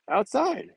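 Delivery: Outside thrown up high in disbelief; incredulous stress. Real, not acted. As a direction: echo Outside as if it's absurd. Disbelief at outdoor mask rule.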